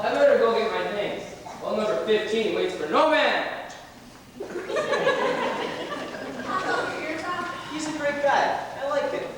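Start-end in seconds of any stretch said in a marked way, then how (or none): 4.4-7.7 laughing. Acted. Laugh before compliment.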